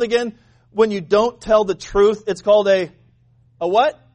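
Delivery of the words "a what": normal talking voice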